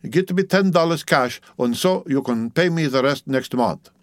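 put-on businesslike Dutch-accented woman; Dutch midwife, bargaining.

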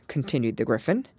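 ordinary delivery, nothing marked

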